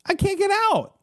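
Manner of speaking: very high cry to the guards; acted yelling, pitched far above normal; high trapped yell. A narrator's reading. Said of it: "you yell at the guards".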